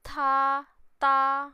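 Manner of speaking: ta called out high and loud; tone demo. Shown as a demonstration. Teacher showing how ta is read in Mandarin. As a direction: belt ta high, twice. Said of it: "Sama apa lagi ya".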